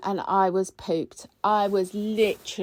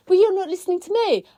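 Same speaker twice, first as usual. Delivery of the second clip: quick, higher mock retort; retold argument; 11 semitones higher, 4 dB louder, faster than usual. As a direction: fire it back playfully. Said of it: "And I'm going".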